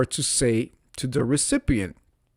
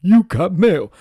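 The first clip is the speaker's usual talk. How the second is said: high, loud email announcer; email greeting imitation; 7 semitones higher, 8 dB louder than usual. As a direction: chirp it out like a computer greeting.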